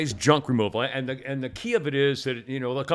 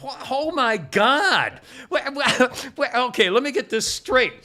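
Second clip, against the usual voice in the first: mock disbelief; 9 semitones higher, 3 dB louder than usual. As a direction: play the guys stunned and giggling. Guys react to the ad.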